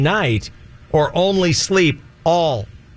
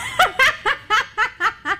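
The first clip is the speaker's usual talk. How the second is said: sudden high-pitched laugh; high burst of laughter. Real, not acted. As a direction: let out a real high laugh. Host laughs at crude answer.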